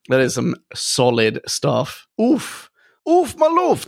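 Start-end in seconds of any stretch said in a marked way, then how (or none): 2.2-3.9 high squeaky exclamation. Real, not acted. Playful exclamation.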